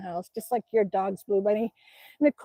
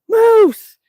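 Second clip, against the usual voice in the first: loud, high warning yell; alarmed shout; 10 semitones higher, 14 dB louder than usual. Retold or imitated. Niece warning her uncle about a moose, alarmed shout. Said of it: "I looked up and I said".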